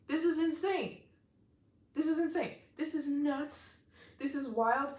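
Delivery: can't believe the donation, let it burst out; real astonished thrill at the gift; excited disbelief. Real, not acted. Gushing over a big donation.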